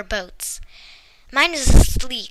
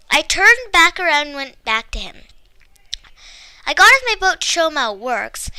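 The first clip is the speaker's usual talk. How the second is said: plain reading voice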